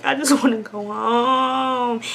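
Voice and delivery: slow, drawn-out mock whimper; half-hearted tearful plea, stretched out; imitated crying. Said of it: "I'm just crying looking at the police officer".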